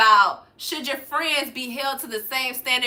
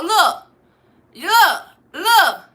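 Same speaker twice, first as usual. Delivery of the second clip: higher and louder call for attention; 6 semitones higher, 7 dB louder, much slower than usual. Real, not acted. Calling out to the troll to look, loud. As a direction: demand he look, punching each word.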